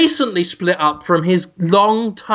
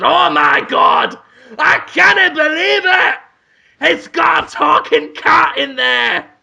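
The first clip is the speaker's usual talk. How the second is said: high, shouted cartoon villain; scheming neighbor, loudly amazed; 8 semitones higher, 3 dB louder than usual. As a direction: exclaim in a pitched-up, hammed-up voice. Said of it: "played by [name]".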